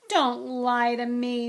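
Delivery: slow, loud, anguished protest; tearful gasp. A narrator's reading. Myrtle refusing Hermione's reassurance, tearfully gasping. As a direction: protest slowly through welling tears. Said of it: "Myrtle gasped, tears now flooding".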